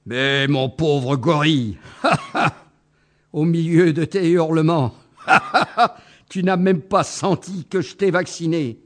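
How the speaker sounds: speaking between loud laughs; laughing through words. Acted. Elephant tells the gorilla he was vaccinated, laughing. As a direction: still half laughing as you explain. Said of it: "l'éléphant se calma à moitié".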